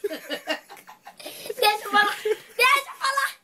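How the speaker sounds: drawn-out, high mock whine; play-whine to get a word in, stretched and rising high; pleading to speak. Doll fights to be heard over a rival, mock whining. Interrupted in an acted doll quarrel.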